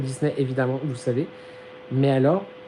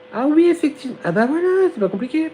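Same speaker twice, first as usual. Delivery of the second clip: mock high agent; 11 semitones higher than usual. Agent's reply imitated.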